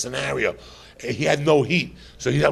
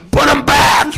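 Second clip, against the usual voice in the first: sudden shout; 14 semitones higher, 9 dB louder, slower than usual. Retold or imitated. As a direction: yell it high and loud, sudden. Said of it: "she'd look at you and she'd say".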